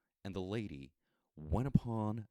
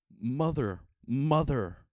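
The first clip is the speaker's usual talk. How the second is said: calling out; 7 semitones higher, 6 dB louder, slower than usual. Acted. Child's voice calling from afar.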